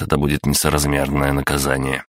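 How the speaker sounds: ordinary delivery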